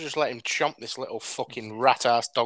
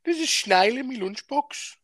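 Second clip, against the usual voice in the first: high-pitched mock Brummie; exaggerated Birmingham accent, pitched way up; 11 semitones higher than usual. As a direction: complain like a cartoon Brummie. Continues mock Brummie lines.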